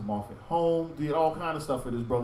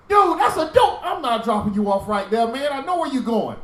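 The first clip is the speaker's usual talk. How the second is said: much higher and louder, outraged; indignant refusal; 7 semitones higher, 8 dB louder than usual. Retold or imitated. Refusing the brother a ride to a dope hole, loud indignation. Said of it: "and I'm like".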